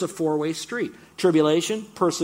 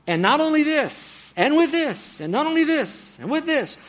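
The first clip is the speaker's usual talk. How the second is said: excited; 12 semitones higher, 6 dB louder than usual. Real, not acted. Preacher repeating the phrase excitedly.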